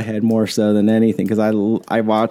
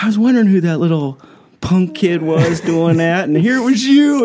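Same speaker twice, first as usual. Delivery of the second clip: mock scolding that climbs higher; mock indignant Mike; 6 semitones higher than usual. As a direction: play the annoyed pilot catching the culprit. Mike Finley quoted, rising pitch.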